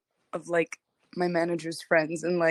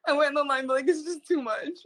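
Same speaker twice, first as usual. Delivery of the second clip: teary; 6 semitones higher, faster than usual. Retold or imitated. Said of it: "I'm, like, crying".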